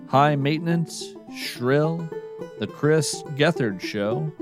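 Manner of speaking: ordinary delivery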